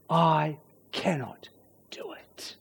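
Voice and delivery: slow and soft, sinking to a whisper; hushed admission. Acted. Preacher admitting the awful truth, slow and hushed. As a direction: let it drop away into a hushed confession.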